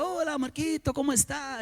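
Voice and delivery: lightly voiced nervous hello to the secretary; sweet greeting. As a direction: greet her gently, a little nervous.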